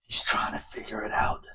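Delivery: hushed, breathy storyteller's whisper; dramatic whisper. Acted. Narrator hushing viewers into a dark story, whispered. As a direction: whisper it like a trailer voice-over.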